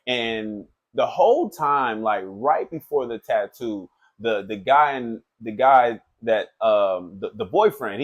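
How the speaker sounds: usual speaking voice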